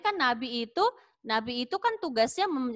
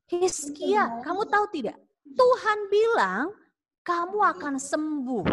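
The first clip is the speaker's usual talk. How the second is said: prophet's good news; 3 semitones higher than usual. Acted. Prophet Isaiah telling the sick king he will live, excited. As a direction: tell the king joyfully, building excitement.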